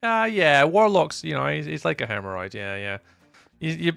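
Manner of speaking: ordinary talk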